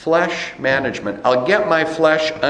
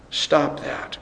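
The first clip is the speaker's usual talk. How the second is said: softer rebuke; 6 dB quieter than usual. Retold or imitated. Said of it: "reminders from the Holy Spirit".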